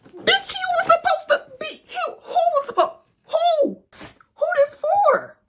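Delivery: screaming very high and loud, half laughing; furious shout. Real, not acted. Reacting to the girl's post about her man, furious high screaming. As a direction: scream at the screen in disbelief.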